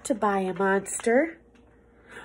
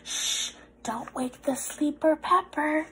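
soft hushed warning; quiet, secretive shush; hushing; 2 semitones higher, 6 dB quieter than usual. Acted. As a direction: shush so he won't wake. Don't wake sleeping monster.

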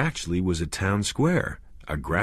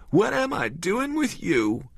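crying; 13 semitones higher than usual. A narrator's reading.

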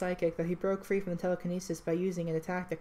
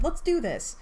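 loud, higher rallying shout; 8 semitones higher, 5 dB louder, slower than usual. A narrator's reading. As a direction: fire yourself up with a shout. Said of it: "he shouted, trying to psych himself".

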